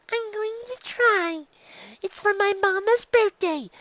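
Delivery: put-on high, slow small child's voice; a shy young filly, high and unhurried; little filly voice. Dicky answers classmates.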